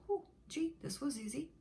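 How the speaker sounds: high, breezy mock relief. Shopper at the store, pleased, in high breezy relief. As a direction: sound like it was effortless. Shopping after money came.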